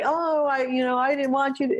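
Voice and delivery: dog voiced protesting the eye drops; voicing the dog. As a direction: play the dog, lightly put out.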